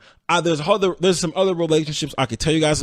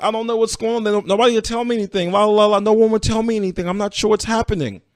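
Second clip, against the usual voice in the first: higher, breezy feigned innocence; girlfriend's innocent voice; 8 semitones higher, faster than usual. Retold or imitated. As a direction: toss it off sweetly like nothing's wrong, pitched up. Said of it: "She's like".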